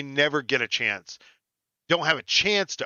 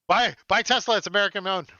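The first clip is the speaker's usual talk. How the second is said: hammed-up pitch, voice jumped high and louder; mock sales pitch; 8 semitones higher, 4 dB louder than usual. Host jokingly pumping his own Tesla stock, mock pitchman. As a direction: sell it like a cheesy ad.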